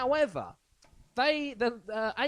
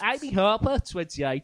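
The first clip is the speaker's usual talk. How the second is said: put-on higher, louder Birmingham accent; 5 semitones higher, 7 dB louder than usual. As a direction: read it like a Brummie. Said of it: "do it in a Birmingham accent".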